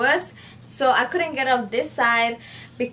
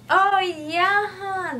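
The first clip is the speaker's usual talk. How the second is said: sudden recognition; 9 semitones higher, much slower than usual. Retold or imitated.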